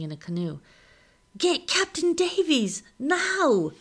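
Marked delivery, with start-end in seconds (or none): 1.4-3.7 high, loud calling out; urgent shout. A narrator's reading. Someone arriving by canoe calling for the captain, shouting. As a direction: yell the order with urgency. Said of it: "The shout is closer".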